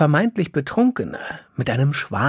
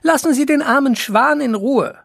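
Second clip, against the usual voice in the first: high-pitched, scolding call; 10 semitones higher, 4 dB louder than usual. A narrator's reading. Ilka telling the fireman off about the swan, indignant call. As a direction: call up a reproachful order, pitch well raised. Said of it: "rief Ilka hinauf".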